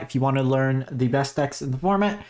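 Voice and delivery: normal talking voice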